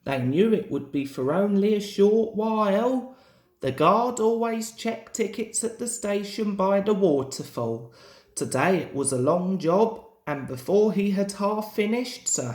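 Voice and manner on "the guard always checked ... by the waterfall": ordinary delivery, nothing marked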